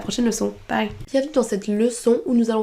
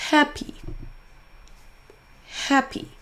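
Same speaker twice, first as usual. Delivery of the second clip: HAP in "Happy" higher and stronger; stressed syllable emphasized; 5 semitones higher than usual. Shown as a demonstration. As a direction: punch the first syllable of "Happy". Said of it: "en prononçant plus fort la syllabe". (première syllabe de « Happy » accentuée)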